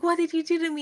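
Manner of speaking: a hint of horror; mock alarm. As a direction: accuse him in mock fright. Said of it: "And she's like".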